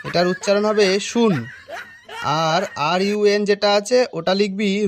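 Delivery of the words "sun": "শুন" said as shoon; wrong pronunciation. Acted. Bluffing teacher dictates "শুন" for sun, mispronounced. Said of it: "উচ্চারণ ভুলভাল বলছে".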